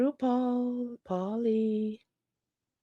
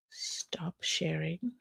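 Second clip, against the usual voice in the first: whispered; 3 semitones lower, 11 dB quieter, much slower than usual. Real, not acted. Whispering to self.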